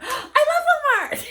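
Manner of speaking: high, slow, loud gush with a laugh; gushing interrupter. Retold or imitated. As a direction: cut in overexcited.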